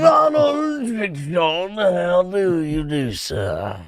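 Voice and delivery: slow, high, posh-sounding colonial British greeting; hammed-up colonial British gent introducing himself; colonial British accent. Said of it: "It's like colonial British".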